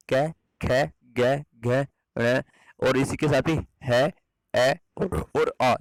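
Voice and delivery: "क ख ग घ" each sounded separately from the throat; throat sounds demo. Shown as a demonstration. Teacher to students, sounding out "क, ख, ग, घ" from the throat. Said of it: "ये ध्वनियाँ कहाँ से निकलती हैं? कंठ से".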